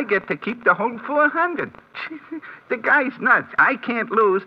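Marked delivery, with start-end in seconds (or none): 2.0-2.7 a quick hushed laugh to himself; short soft chuckle. Acted. Bookie snickers over his sure win, short chuckle. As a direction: snicker at the fool's bet. Sam tickled by the no-lose bet.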